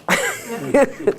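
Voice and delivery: short real laugh at own joke. Real, not acted. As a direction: laugh at the slip.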